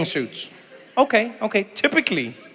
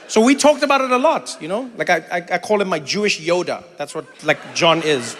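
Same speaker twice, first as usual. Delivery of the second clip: normal talking voice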